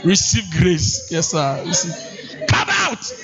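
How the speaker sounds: hammed-up preacher casting out; mock preacher. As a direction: call it out like a deliverance prayer. Mock preacher deliverance scene.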